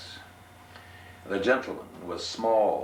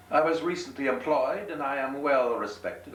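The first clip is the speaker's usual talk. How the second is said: higher gentleman voice; 8 semitones higher, 3 dB louder than usual. Acted. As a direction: answer the sailor with pride. Gentleman answers sailor.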